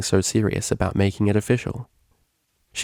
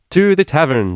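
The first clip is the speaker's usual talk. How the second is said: loud, dramatic proclamation; grand declaration; 8 semitones higher, 7 dB louder than usual. A narrator's reading. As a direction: announce it like a battle cry. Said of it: "declared the D J".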